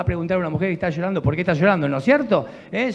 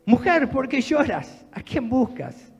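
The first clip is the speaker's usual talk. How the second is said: gentle Jesus; 6 semitones higher, slower than usual. Acted. Jesus speaks to weeping Mary.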